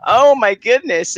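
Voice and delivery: high, loud, slow "Oh my goodness"; sinking heart. Retold or imitated. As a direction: let it drop like your heart just sank. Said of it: "my heart sunk to the ground".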